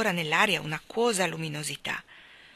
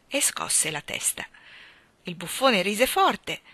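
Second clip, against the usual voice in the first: plain reading voice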